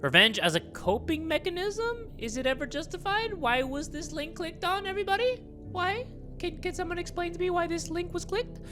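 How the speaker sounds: high incredulous. Real, not acted. Finds creepy search history.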